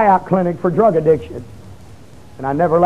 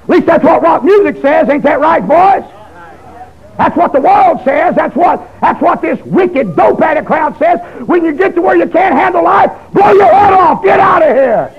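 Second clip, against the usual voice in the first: much higher and louder, a crying shout at the end; full-force preaching; 9 semitones higher, 4 dB louder than usual. Acted. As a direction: preach hard, mocking what the world says.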